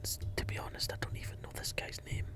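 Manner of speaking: unvoiced conspiratorial whisper. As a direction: lean in and whisper it. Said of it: "palm him closer and whisper".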